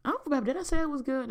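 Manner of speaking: high, fast fake-fine brush-off; sing-song fake cheer to hide a bad day; fake cheerful. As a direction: put on a bright fake okay. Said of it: "you give people the fake".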